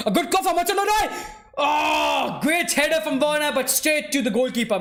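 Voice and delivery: high, surging cry as the chance comes; excited match call. Real, not acted. Commentator watches a header saved, excited then easing. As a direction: shoot up in pitch, then ease off with the miss. Live call of a near goal.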